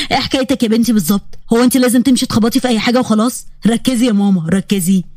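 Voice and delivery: low teasing grown-up scolding a clumsy student; Yazan teasing gently. Acted. Yazan teasing Huda after she hits the wall, low and amused.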